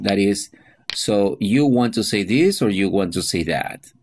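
ordinary delivery

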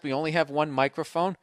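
usual speaking voice

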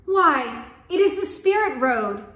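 measured, expressive storybook reading; story narration. A narrator's reading. Storyteller reading a folk tale aloud, calm narration. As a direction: tell the tale gently to listeners.

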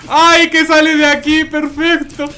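high, loud, slowed yelp of relief; excited shout. Real, not acted. Streamer yelling to chat as his character escapes, excited shout. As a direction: scream it out as you barely escape.

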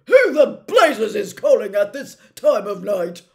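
angry uncle yelling at the doorbell; furious shout. Acted. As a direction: roar it down the hall at a late caller. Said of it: "his Uncle Vernon shouted".

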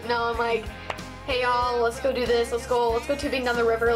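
ordinary delivery

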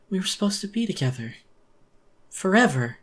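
high, fast, breaking into a desperate cry; anguished wail. Acted. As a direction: let the grief spill over into a wail. Monster grieving her lost lover.

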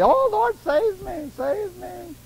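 high panicked plea fading; high desperate call, dying away; panicked cry for help. Retold or imitated. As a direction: cry out like you're sinking. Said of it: "he's hollering immediately".

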